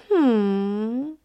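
high thoughtful hum; monkey's hmm. Acted. Monkey weighing the offer, a high musing hmm.

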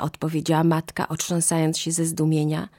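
ordinary delivery, nothing marked

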